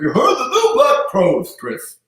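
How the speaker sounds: a clumsy announcer impression; bad Howard Finkel. Imitating the announcer chatting about music. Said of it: "I'm doing the worst Howard Finkel".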